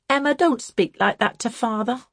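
high, cold reprimand; cold, sharp. A narrator's reading. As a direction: rebuke a sister sharply, coldly. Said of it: "in a tone not so cold but just as sharp".

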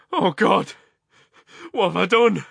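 high, loud anguished outcry; horrified cry. A narrator's reading. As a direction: cry out in horror at yourself. Horrified at what he did.

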